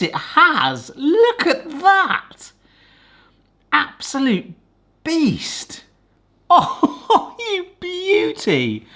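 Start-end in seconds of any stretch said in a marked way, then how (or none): 1.0-5.8 slow, high delighted gasp of a line; thrilled. Real, not acted. Seeing the gooey inside. 6.5-8.9 high, slow adoring coo; real laughing delight. Real, not acted. Admiring the cut brownie.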